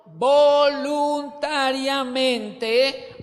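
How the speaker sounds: "voluntariamente" loud, syllable by syllable; "voluntariamente" very slow, drawn out and loud; stretched emphasis. Real, not acted. Preacher hammers the key word.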